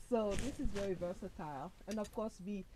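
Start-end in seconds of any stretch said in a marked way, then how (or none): none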